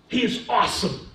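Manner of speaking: usual speaking voice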